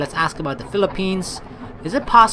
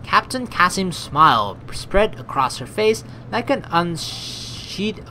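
ordinary delivery

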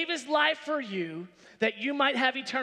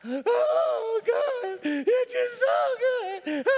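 high, drawn-out fake sobbing; hammed-up tearful praise; mock crying; 10 semitones higher, 4 dB louder, much slower than usual. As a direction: wail it like an overemotional worshipper. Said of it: "God wants you crying every worship service".

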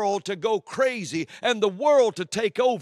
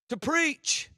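pitched up high in disbelief; indignant echo; 7 semitones higher than usual. Real, not acted. Preacher to congregation about who may preach, indignant echo. Preacher repeats phrase in outrage.

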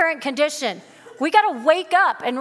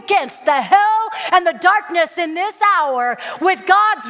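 full-force preaching; 3 semitones higher, 4 dB louder than usual. Acted. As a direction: thunder it out to the congregation.

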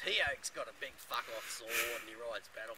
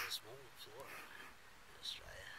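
hushed nature host; 9 dB quieter than usual. Acted. Mock nature host hushing.

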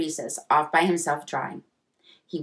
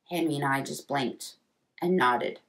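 plain reading voice

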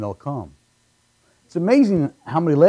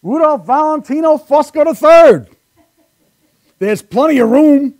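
excited shout; 12 semitones higher, 8 dB louder, slower than usual. Retold or imitated. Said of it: "I said".

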